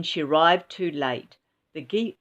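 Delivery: ordinary delivery, nothing marked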